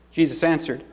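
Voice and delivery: ordinary delivery, nothing marked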